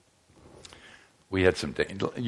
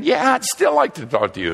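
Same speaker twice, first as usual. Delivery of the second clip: put-on eager young man; 5 dB louder than usual. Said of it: "the young was saying".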